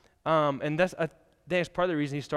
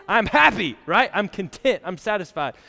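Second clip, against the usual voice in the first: louder and higher, with glee; excited cheer; 5 semitones higher, 8 dB louder than usual. Retold or imitated. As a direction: burst out like a student who just finished school. Cheering graduates after finishing school.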